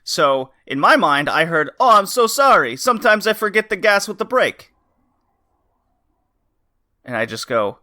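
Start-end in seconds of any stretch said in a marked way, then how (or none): 1.8-4.7 mock Italian accent, higher and louder. Driver apologizing after hitting a parked car, Italian accent. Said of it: "an Italian accent from him".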